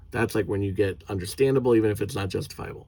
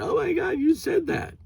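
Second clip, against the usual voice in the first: high whiny mock outrage, much higher; mocking offended person. Mocking people who virtue signal, high whiny fake outrage. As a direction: mimic a prissy offended scold. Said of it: "virtue signal all the time getting mad".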